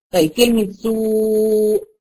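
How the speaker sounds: سوء with the long waw held before the hamza; سوء بمد الواو; stretched madd. Shown as a demonstration. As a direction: slowly draw out the waw in سوء. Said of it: "أهو، مد بالواو".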